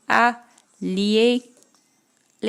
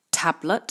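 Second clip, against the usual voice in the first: Tablet with the stress on TAB-; first-syllable stress. Shown as a demonstration. Teacher showing Tablet to the class, first syllable stressed. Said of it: "première syllabe pour l'accentuation".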